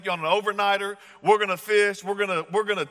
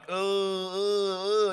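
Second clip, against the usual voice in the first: imitated moaning cry; 3 semitones higher, much slower than usual. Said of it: "just kinda like a moan".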